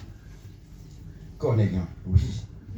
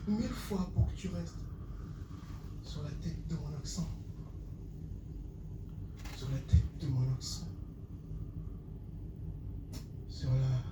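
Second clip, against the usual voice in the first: fading slam recital; 6 semitones higher, 9 dB quieter than usual. Acted. Poet vows to repeat his text, fading slam. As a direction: let each repeat grow quieter.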